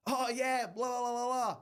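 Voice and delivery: high, loud fake agreement; hammed-up nodding-along voice; mock joining-in. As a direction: bluff along with a babbled imitation of chat. Mimics joining in without understanding.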